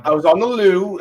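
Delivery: a quick mock Irish accent for the show. Cohost asked to do Irish, a mock Irish accent. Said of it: "in your best Irish accent".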